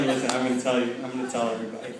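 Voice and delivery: soft, low warning; quiet threat. Retold or imitated. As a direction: lean in and threaten softly. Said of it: "I was like".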